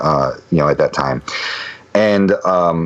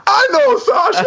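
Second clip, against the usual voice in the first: very high, shouted surprise; astonished shout. Retold or imitated. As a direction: burst out in sheer amazement. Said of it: "And he goes".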